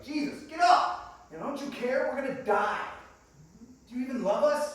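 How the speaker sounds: loud, high, accusing shout; desperate yelling. Acted. Frightened disciples demanding Jesus wake up, desperate yelling. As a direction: yell to rouse a sleeper in a crisis. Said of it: "I would be yelling to Jesus".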